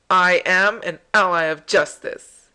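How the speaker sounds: loud, proclaimed hero line; heroic declaration. Acted. Answering who do you think you are, loud mock-heroic. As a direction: declare it like a champion. Voicing hero's reply in game dialogue.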